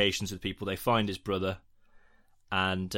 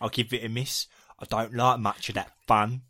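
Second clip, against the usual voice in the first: mock English accent; hammed-up bad English accent; American's English accent. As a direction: imitate an American trying to sound English. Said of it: "doing English accents".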